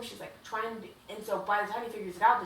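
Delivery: normal talking voice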